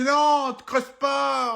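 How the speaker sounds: high, loud shout; shout at dog. Acted. Dog walker shouting at a dog digging, loud. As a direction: stop the dog with a yell.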